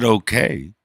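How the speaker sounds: imitated loaded okay; unnerving okay. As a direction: mimic the kid's ominous okay. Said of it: "the way he said".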